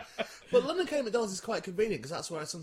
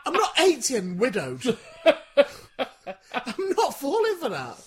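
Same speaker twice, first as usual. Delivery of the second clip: higher, laughing jokey defiance; 8 semitones higher, 9 dB louder than usual. Real, not acted. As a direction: crack up mid-joke. Laughing at own joke.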